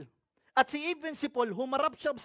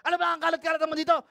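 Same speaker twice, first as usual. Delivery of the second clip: high, loud, rapid-fire scolding; shouting spouse; 6 semitones higher, 6 dB louder, much faster than usual. Pretend. Spouse scolding over the mess, mock shouting. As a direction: mimic a spouse yelling in a fast, raised voice. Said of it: "Sinisigawan ka na ng asawa mo".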